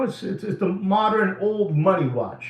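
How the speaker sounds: ordinary talk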